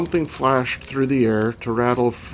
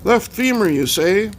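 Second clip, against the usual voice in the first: put-on high, creaky old-timer calling out; raised, aged croak; ancient croaking voice; 9 semitones higher, 5 dB louder than usual. A narrator's reading. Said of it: "came an ancient croaking voice from nearby".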